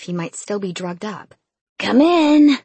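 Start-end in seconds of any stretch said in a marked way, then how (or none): 1.8-2.6 loud, high groggy call; groggy shout. A narrator's reading. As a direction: shout it half awake. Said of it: "he shouted groggily".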